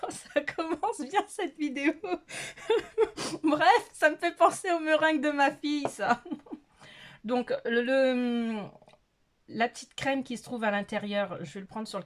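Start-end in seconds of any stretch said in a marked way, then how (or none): 0.1-6.2 high, giggly voice getting louder; laughing while talking. Real, not acted. Vlogger cracking up at her own video, laughing. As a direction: talk through a fit of giggles.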